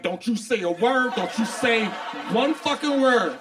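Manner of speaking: hammed-up jealous warning; mock warning boyfriend. Man warning his partner.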